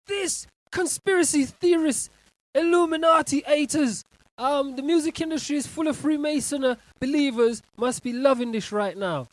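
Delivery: loud, gleeful shouting; excited shout. Real, not acted. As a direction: yell it out with glee. Shouting about conspiracy fans.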